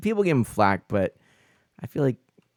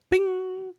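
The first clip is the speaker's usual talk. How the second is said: very high ringing "bing"; ping it out like a tiny bell; imitated bing. Streamer voicing the crafting moment, high bing.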